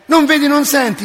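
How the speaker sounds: high, loud rebuke; loud mock scolding of a boy. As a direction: shake him awake with the words. Scolding a boy in retold dialogue.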